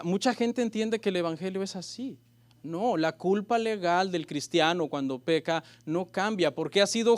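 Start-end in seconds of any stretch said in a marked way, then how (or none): none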